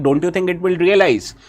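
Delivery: normal talking voice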